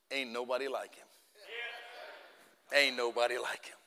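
low, soft and solemn; quietly reverent, pitched well down; hushed title reveal. Real, not acted. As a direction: drop the voice low and let it land.

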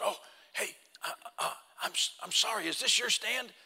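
hushed, halting, apologetic; a hint of an embarrassed stranger caught out; sheepish apology. Stranger in the stand apologizing to the hunter, hushed and halting.

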